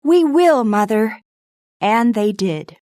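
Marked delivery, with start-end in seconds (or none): 0.1-1.2 high, loud, eager cry; the girls eagerly answer their mother; eager promise. Acted. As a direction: promise it with all your heart.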